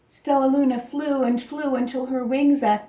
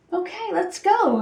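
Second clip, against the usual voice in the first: eager agreement; 7 semitones higher than usual. A narrator's reading. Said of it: "agreed Pip".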